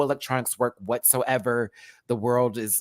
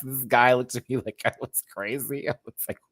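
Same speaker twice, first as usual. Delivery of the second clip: normal talking voice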